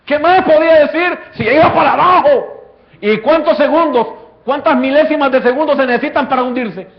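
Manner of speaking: loud, rising sermon climax; preaching at full force. Acted. Preacher building the sinking scene for the church, full force. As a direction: drive the story home to the congregation.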